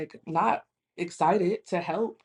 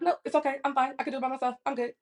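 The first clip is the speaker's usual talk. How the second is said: higher-pitched mock huffy refusal; immediate attitude; 6 semitones higher, much faster than usual. Friend refusing offered company, imitated with huffy attitude. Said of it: "She, immediate attitude".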